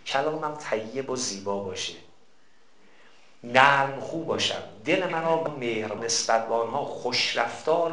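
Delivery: slow, soft recitation of a supplication; slow quiet prayer. Acted. Preacher slowly reciting the prayer for parents, softly. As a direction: let each phrase of the prayer settle, slowly and softly.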